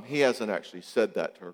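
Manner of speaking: ordinary talk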